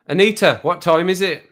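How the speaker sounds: quick, high, loud call; said fast. Shown as a demonstration. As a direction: rattle the question off to the assistant. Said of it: "Maybe if you say it fast".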